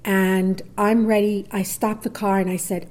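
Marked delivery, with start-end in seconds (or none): none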